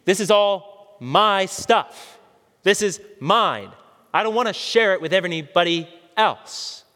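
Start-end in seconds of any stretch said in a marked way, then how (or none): 0.0-3.7 slow, with "my" and "mine" hit hard; stressed my. Shown as a demonstration. Preacher spelling out the verse's emphasis, slow and pointed on "my". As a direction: drag out "This is all my stuff", punching "my" and "mine". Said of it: "Did you hear the emphasis?".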